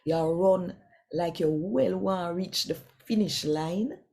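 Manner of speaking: normal talking voice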